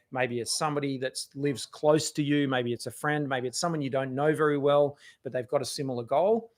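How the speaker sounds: normal talking voice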